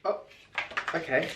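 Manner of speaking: ordinary talk, nothing marked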